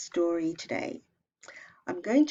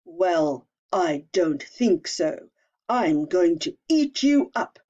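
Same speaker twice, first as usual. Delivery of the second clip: slow, menacing troll growl, louder; 2 semitones lower, 7 dB louder than usual. Acted. Troll menacing the little goat, slow and loud. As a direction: loom over the little goat, slow and threatening.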